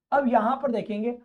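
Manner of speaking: ordinary talk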